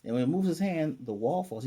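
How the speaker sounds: normal talking voice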